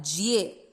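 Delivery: जिए with ज and ए blended; जिए like the start of ज्योति; ज्ये as जिए. Shown as a demonstration. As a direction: say जिए as in ज्योति, not जये. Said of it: "जये नहीं पढ़ना है".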